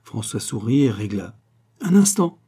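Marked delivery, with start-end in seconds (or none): 1.8-2.4 high, loud urgent call; sudden raised-voice exclamation; crying out. A narrator's reading. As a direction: call out to halt them. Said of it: "s'écria Guérac".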